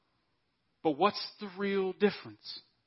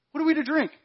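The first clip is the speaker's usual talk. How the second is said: much higher, whining repeat; the crowd's second, more insistent grumble; louder grumbling complaint; 11 semitones higher, faster than usual. Retold or imitated. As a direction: whine it again, pushing harder. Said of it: "So the people grumbled against Moses".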